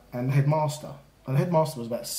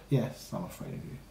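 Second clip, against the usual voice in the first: low, soft, smiling fake surrender; boy mocking the headmaster's threat, smiling; smiling sarcastic concession; 6 semitones lower, 8 dB quieter than usual. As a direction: give in with a knowing smile that means the opposite. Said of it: "and I smiled".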